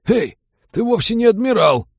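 very high, loud pirate voice; unsure protest. A narrator's reading. Pirates protest to the false admiral, high-pitched and unsure. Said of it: "неуверенно запротестовали".

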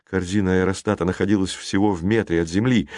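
usual speaking voice

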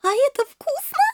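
very high-pitched innocent question; curious little princess. A narrator's reading. Princess asking kittens about Korean dish, curious high voice. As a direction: ask it with naive girlish curiosity. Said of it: "быстро уточнила любопытная принцесса".

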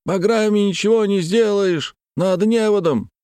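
high calling voice; high urgent shout. A narrator's reading. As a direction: holler advice across the water. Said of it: "закричали с берега".

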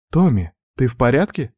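worried big sister checking in; anxious sister on the phone. A narrator's reading. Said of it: "прозвучал обеспокоенный голос Арины".

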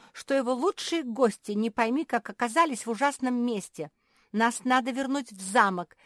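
high, loud demands; indignant shouting. A narrator's reading. Marsia demanding a return to the castle, shouting. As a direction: call out imperiously to the jailer. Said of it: "закричала Марсия".

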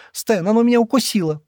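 high, frightened cry of pain; high panicked yelp to Stan. A narrator's reading. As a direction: cry out in fear, she's just been bitten. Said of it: "испуганно крикнула Шира".

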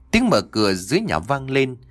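ordinary delivery, nothing marked

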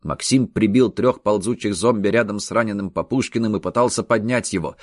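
plain reading voice

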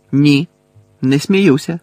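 firm denial. Acted. Harry denies he is joking to Bellatrix, firm and loud. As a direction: shut down her taunt. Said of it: "заперечив Гаррі".

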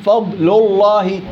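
فضل thin and clean, الله fully heavy; slow model recitation; letters kept apart. Shown as a demonstration. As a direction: keep each letter clean and distinct. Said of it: "هذا بسموه تخليص الحروف".

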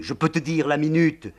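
high, loud, obsessive litany; raving madwoman. Acted. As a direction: rant it at them. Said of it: "La générale continue".

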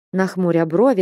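plain reading voice

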